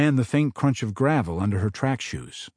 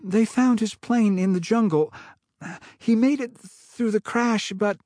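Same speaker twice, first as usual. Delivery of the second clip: lightly voiced grieving mother; a hint of a mother breaking bad news; mom's voice; 9 semitones higher than usual. A narrator's reading. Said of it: "heard her voice echoing".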